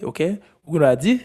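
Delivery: normal talking voice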